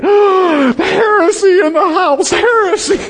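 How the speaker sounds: mock outraged cry. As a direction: play the scandalised churchgoer. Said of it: "I know you're probably thinking".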